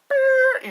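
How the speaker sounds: high, loud drawn-out imitated noise; long high-pitched mock blast; imitated sound effect. Imitates a sudden movie sound.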